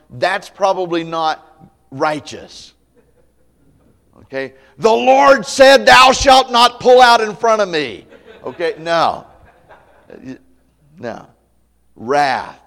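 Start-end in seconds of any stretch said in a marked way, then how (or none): 4.8-8.1 loud, high, slow mock commandment; mock angry yell. An angry driver yelling at traffic, mock booming. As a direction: bellow it like a pompous decree. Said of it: "start getting mad and yelling".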